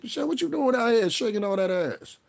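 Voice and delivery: high, teasing call-out; mock calling out. Host teasing Michelle Obama about twerking, high mock call-out. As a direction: tease her like you caught her. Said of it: "I was like".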